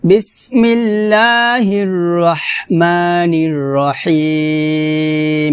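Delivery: slow, drawn-out tajweed chant; Quran recitation. Shown as a demonstration. Teacher recites the opening before the lesson, slow melodic chant. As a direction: recite it slowly and melodically like the start of a Quran reading.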